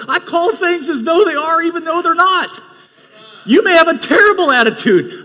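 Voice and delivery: high, loud, fired-up preaching; full-force preaching. Acted. Pastor answering his critics, loud fiery preaching. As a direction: preach it hard to the congregation.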